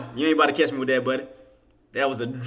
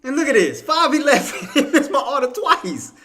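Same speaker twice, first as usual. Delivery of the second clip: much higher disbelieving complaint; incredulous laughing; 13 semitones higher than usual. Real, not acted. To viewers after missing orders twice, incredulous laughing.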